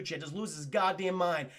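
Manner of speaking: usual speaking voice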